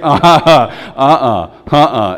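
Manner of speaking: loud repeated disapproving uh-uh; grandparent's firm no; stern uh-uh. Acted. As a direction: shut it down, no arguments. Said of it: "You're like".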